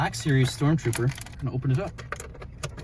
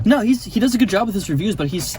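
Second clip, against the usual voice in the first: ordinary delivery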